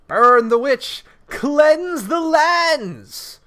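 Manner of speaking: loud, high rallying cry; angry mob leader. Pretend. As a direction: rouse the torch-bearing mob. Mob chant at the witch.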